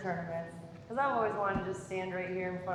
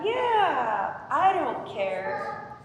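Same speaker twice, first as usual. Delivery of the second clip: higher, louder, drawn-out good-guy reply; imitated easygoing golfer; 8 semitones higher, 6 dB louder, much slower than usual.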